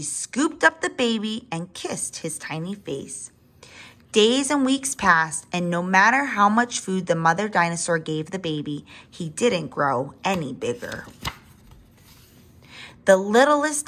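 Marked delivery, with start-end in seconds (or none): none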